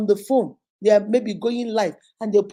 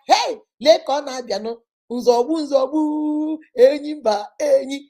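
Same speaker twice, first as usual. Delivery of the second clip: loud shouted praise names; hyped hailing; 2 semitones higher, 6 dB louder than usual. Retold or imitated. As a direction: hail the man like a champion arriving. Girlfriend loudly hails boyfriend.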